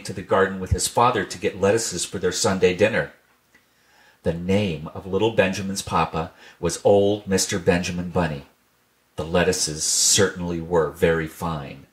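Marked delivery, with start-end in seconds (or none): none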